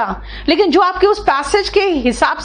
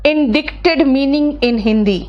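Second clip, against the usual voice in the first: slow, carefully spaced dictation; dictating to a phone app, slow and deliberate; slow voice search; much slower than usual. Shown as a demonstration. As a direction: speak each word clearly to the phone. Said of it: "mobile में ऐसे बोलो".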